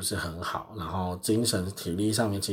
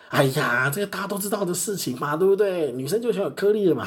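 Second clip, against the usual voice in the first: smug customer; 9 semitones higher than usual. Retold or imitated. Customer smoothing things over, higher smug voice. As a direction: brush it off as common knowledge. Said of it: "他就说".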